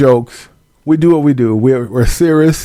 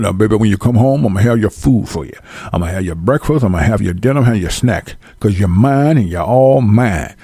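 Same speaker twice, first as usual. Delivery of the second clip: husband voiced lower, promising food; doting little man; 5 semitones lower than usual. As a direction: coo to your baby about meals, low. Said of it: "Get you a Gary Coleman".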